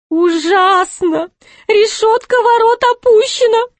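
very high, wailing jester voice; moaning. A narrator's reading. Jester sees the gate is down, moaning. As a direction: moan it out high and drawn in dismay. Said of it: "простонал Шут".

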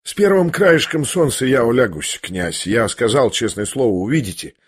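usual speaking voice

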